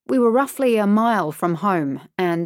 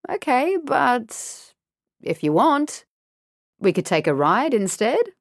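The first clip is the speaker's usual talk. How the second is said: creepy friendly offer; 6 semitones higher than usual. Acted. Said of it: "It sounded like a question, but it didn't feel like one".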